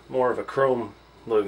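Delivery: ordinary delivery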